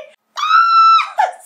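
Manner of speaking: high, loud shriek of laughter; overjoyed squeal-laugh. Real, not acted. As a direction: burst into a screaming laugh of pure joy. Unboxing the play button, overjoyed.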